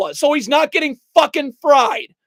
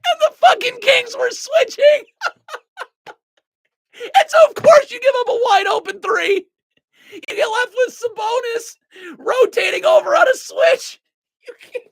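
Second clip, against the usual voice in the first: high-pitched, explaining through real laughter; laughing incredulity; 7 semitones higher than usual. Real, not acted. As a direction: push the explanation out before laughter takes over. Explains blunder while cracking up.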